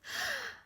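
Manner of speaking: sharp, high intake of breath; big breath before the poem; deep gasp. Acted. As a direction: suck the air in before speaking. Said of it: "Leonard took a deep breath".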